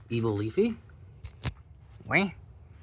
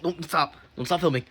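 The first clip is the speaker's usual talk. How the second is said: ordinary talk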